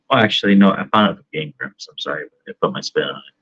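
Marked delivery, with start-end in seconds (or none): none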